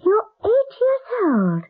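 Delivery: high, slow, near tears with shock; can't believe how small he is, voice catching; choked astonishment; tearful disbelief. Acted. Shocked at the boy's age.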